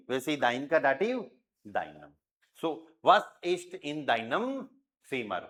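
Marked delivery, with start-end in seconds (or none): 2.6-5.4 slow, careful read; slow model sentence. Shown as a demonstration. Teacher reading German example slowly to students. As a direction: stretch every word out. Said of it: "teacher modelling sentence slowly".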